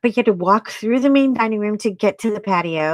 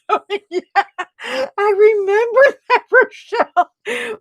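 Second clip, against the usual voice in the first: laughing recognition; 12 semitones higher than usual. Real, not acted. Excited recognition of viewer.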